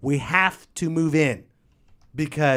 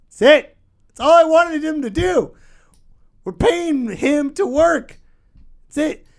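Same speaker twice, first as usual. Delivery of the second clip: frustrated shout; 14 semitones higher, 8 dB louder than usual. Real, not acted. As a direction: let the frustration burst out. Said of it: "Can you hear the frustration in my voice?".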